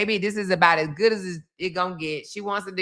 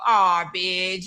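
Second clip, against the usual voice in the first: usual speaking voice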